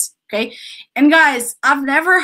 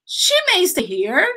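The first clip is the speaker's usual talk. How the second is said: "She may stay here" said higher, with a rise at the end like a question; rising question tune; 7 semitones higher than usual. Shown as a demonstration. Teacher mocking students who make a question with tune alone, rising. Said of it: "you're just adding an intonation".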